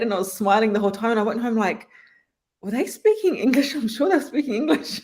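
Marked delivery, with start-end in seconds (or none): 2.6-5.0 high, laughing mock bewilderment; amused bewilderment. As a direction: retell your confusion in a high voice, breaking into laughter. Retelling her own bewildered reaction, laughing.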